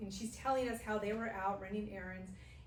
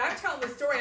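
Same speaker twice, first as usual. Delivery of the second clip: ordinary talk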